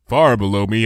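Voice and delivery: ordinary delivery, nothing marked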